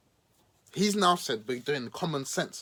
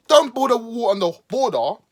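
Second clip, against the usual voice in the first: mock indignant shout; 6 semitones higher, 9 dB louder than usual. Sarcastic rant.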